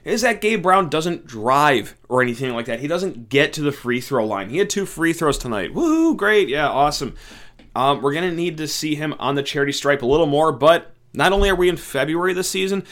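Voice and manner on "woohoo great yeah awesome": mock celebration, dripping sarcasm; sarcastic. Real, not acted. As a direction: fake cheer, high and loud. Two free throws, mocking.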